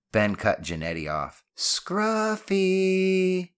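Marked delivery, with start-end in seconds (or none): 1.5-3.5 very high, drawn-out holler; long bellow. Acted. Ben calls the imaginary dog, bellowing. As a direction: bellow it far across the yard, stretching each syllable. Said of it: "Ben bellowed".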